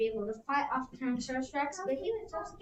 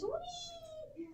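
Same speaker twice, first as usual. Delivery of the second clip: much higher, soft squeal of delight; high gleeful whee; 9 dB quieter than usual. Acted. Edna flying over the Triceratops, high soft whee.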